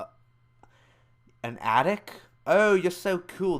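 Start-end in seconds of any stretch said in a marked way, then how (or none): 2.5-3.6 slow, louder sarcastic gush; fake-impressed sneer at the mom; mock gushing. Vlogger mocking the mom, sarcastic gush. Mocking the mom's attic excuse.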